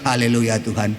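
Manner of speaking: content and at peace; calm relief. Retold or imitated. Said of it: "Dilihatnya istrinya dibilang". (pujian tenang dan rendah)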